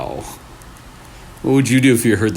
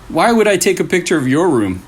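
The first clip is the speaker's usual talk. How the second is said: Kento dismissive; 7 semitones higher than usual. Acted.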